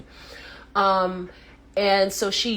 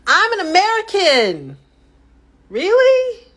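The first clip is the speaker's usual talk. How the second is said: much higher, louder indignant squeal; outraged disbelief; 13 semitones higher, 6 dB louder than usual. Real, not acted. Reacting to the diet list, loud indignant disbelief. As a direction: protest in mock horror.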